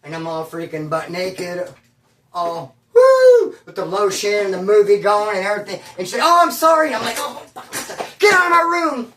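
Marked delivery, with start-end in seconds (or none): 2.3-3.6 high shrieking woo, much higher and louder; startled whoop. Retold or imitated. Caught naked, he lets out a high startled woo. 6.2-6.9 much higher, louder, flustered; startled apology. Retold or imitated. Motel manager startled walking in on him, apologizing. 7.2-9.1 breathy gasp then much higher, louder shout; startled yell. Retold or imitated. Naked son startled, yelling at the manager to get out.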